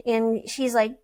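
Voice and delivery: ordinary talk, nothing marked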